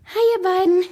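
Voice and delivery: high, loud, excited greeting; excited call. A narrator's reading. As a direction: call out excitedly to two friends. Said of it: "rief eine aufgeregte Stimme". (aufgeregt und hoch gerufen)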